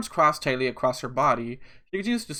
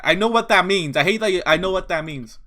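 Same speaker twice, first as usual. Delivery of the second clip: loud, higher-pitched protest; raised voice, pushing back hard; exasperated protest; 5 semitones higher, 8 dB louder than usual. Real, not acted. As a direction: insist loudly, fed up. Protests at the character's hint.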